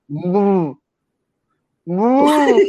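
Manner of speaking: drawn-out, stuck first sound, imitated; struggling to speak. Man trying to curse someone, imitated stuck speech. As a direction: strain at the word as if it won't come out. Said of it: "He couldn't get it out".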